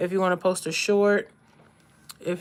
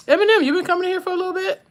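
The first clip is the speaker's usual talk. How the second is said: high and very fast, eager question; excited recognition; 11 semitones higher, 5 dB louder, much faster than usual. Real, not acted. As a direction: rush to greet a familiar viewer. Recognizing a viewer.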